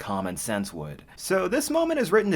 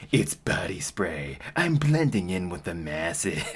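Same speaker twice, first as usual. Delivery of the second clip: slow, low mock-serious character voice; straight-faced line read; 7 semitones lower than usual. Retrying the awful comic line, low mock-serious. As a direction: hold a straight face on stilted dialogue. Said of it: "read this with a straight face".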